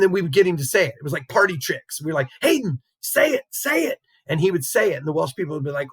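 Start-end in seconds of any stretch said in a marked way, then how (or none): 2.4-4.0 high-pitched imitation of excited coaxing; eager egging-on. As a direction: mimic urging a kid to show off. Retold goading student to perform.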